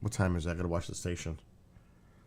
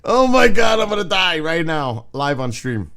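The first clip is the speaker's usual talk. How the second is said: panicked; 6 semitones higher, 10 dB louder than usual. Real, not acted. Panicking at spider video.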